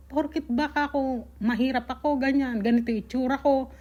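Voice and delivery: normal talking voice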